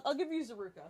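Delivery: normal talking voice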